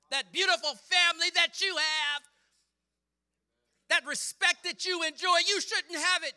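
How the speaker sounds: high shouted exhortation in driving bursts; full-force preaching. Real, not acted. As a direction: hammer each phrase at the congregation, building to a shout.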